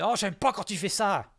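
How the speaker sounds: high, loud imitation of a protest; mock complaint. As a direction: mimic her objecting, high and loud. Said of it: "et Jean Gabin, elle qui est glottophobe".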